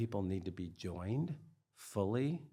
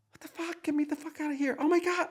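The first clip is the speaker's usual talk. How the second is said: much higher, growing louder, frantic; panicked outburst; 7 dB louder, faster than usual. Retold or imitated. Overwhelmed by a trauma reaction, frantic panicked cry. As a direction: blurt it out as panic takes over.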